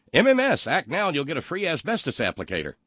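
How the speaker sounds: high, loud huckster opening, easing down; mock infomercial pitch. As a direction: sell it like an over-the-top TV ad. Mock infomercial pitch from listener's message.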